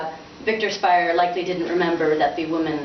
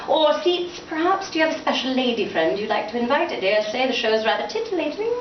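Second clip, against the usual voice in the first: higher, teasing coax; coy coaxing father; 5 semitones higher than usual. Acted. Father teasing son about a date. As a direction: tease him about a lady friend.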